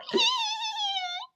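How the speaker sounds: high squealed giggle; bursting giggle. A narrator's reading. Narrator acting out giggles that burst out, high giggle. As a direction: let a high, thin giggle slip out. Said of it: "giggles that threaten to burst".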